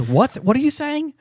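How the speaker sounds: high, carrying call back; calling back confused. Retold or imitated. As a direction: shout back across the clearing. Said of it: "turns back around and says".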